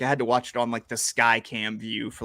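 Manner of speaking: normal talking voice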